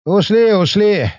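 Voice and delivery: breathless, slow, words between gulps of air; panting. Acted. Ryaba after the forced march, panting for breath. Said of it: "в паузах между глубокими вдохами".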